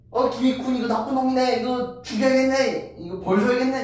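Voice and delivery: high, loud voice of a righteous elder; stern elder condemning. Acted. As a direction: pass judgment like a respected scholar. Park Sechae condemns Kim Ikhun. (높고 큰 목소리로 단호하게 꾸짖는 원로)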